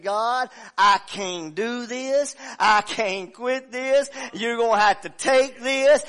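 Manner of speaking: loud, slow, pleading cry to God; desperate prayer. Retold or imitated. Preacher voicing his mother's prayer, loud slow plea. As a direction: cry out in surrender, slowly.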